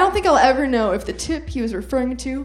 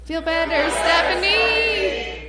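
loud send-off; 7 semitones higher, slower than usual. Real, not acted. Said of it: "let's all say".

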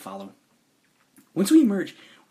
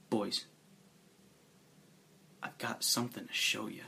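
near whisper; 3 semitones lower, 10 dB quieter than usual. A narrator's reading. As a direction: say it just above a whisper, with long pauses. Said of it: "in a little more than a whisper".

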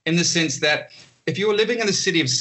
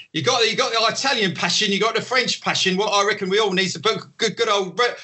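higher, hammed-up Cockney showman waiter; Cockney accent, higher and overdone; mock Cockney; 7 semitones higher than usual. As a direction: play the local British waiter, pitching hard to the crowd in Cockney. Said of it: "go into some kind of Cockney".

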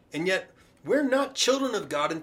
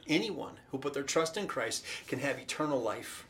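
ordinary talk, nothing marked

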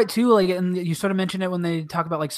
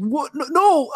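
shocked protest; 9 semitones higher, slower than usual. Retold or imitated. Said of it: "and Belle's like".

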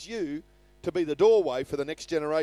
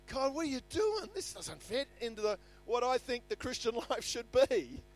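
mock complaint; 5 semitones higher, 4 dB quieter than usual. Mock believer complaining to God.

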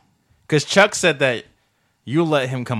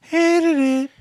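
high, put-on squeal of "Hated it"; mock catchphrase; 4 dB louder than usual. Agreeing about the movie, high campy mock verdict.

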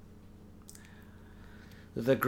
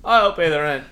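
louder bold hero voice; heroic rescuer; 4 semitones higher, 11 dB louder than usual. Acted. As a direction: stride in and save the day. Acts out old melodrama.

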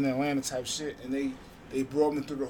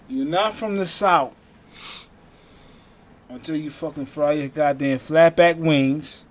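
louder, slow declaration; emphatic proclamation; 2 semitones higher, 10 dB louder, slower than usual. Real, not acted. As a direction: proclaim it slowly like a sermon. Preaching Southern food truth.

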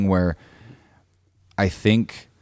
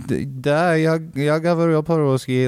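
higher, hesitant learner's Russian; nervous Russian reply; 10 semitones higher than usual. Retold or imitated. Telling the drunk Russians he speaks Russian, high and nervous. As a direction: stammer a flustered yes in Russian.

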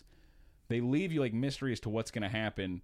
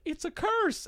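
very high, loud squawk of alarm; hammed-up superstitious cry, pitched way up; mock theater nerds; 6 dB louder, slower than usual. Imitating theater nerds explaining the curse, high mock cry. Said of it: "they were like".

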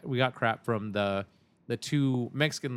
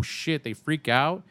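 normal talking voice

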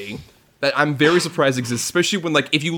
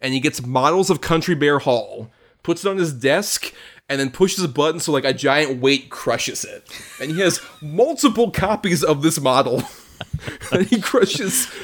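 ordinary delivery

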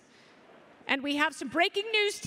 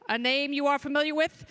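ordinary talk

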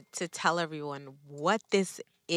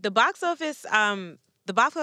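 loud announcing; 4 semitones higher, 8 dB louder than usual. Acted. Loud announcing show name.

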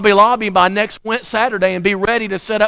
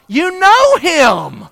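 teasing; 10 semitones higher, 6 dB louder, much slower than usual. Real, not acted. Teasing the congregation about a slide.

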